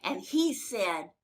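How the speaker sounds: ordinary delivery, nothing marked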